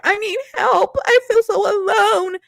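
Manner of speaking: high whiny mock sob; hammed-up imitation of a crying bride; mock crying contestant. Vlogger mimicking the crying bride in a high whiny voice. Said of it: "crying like".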